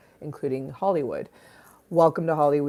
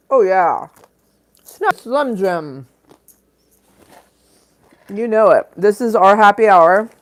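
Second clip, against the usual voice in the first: loud, high hype for the happy hour; 4 semitones higher, 9 dB louder than usual. Acted. Streamer hypes viewers for her happy hour, loud and high. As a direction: pump up the room, loud.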